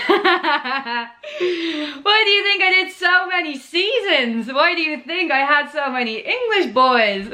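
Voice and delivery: laughing through a playful question; giggling while teasing back. Real, not acted. Asked about her accent, laughing as she answers. Teased about loving the attention.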